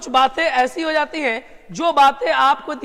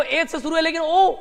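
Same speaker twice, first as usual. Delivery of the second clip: ordinary talk, nothing marked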